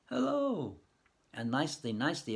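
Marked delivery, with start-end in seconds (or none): 0.0-0.8 echo from the well. Acted. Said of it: "an echo comes out of the well like this".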